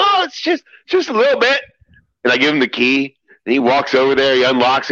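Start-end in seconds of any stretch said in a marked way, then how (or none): 0.0-1.6 much higher, sing-song innocence; high, falsely innocent lilt; mock innocent. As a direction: play it coy and innocent. Coy understated reply, retold.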